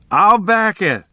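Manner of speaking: high-pitched barroom holler; mock scream. A narrator's reading. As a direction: yell it out, only half at full volume. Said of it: "the Life man screamed".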